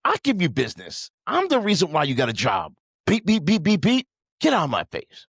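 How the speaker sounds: mock-furious customer tirade with bleeps; angry rant. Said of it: "I got pissed".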